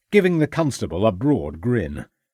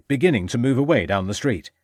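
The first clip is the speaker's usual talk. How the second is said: usual speaking voice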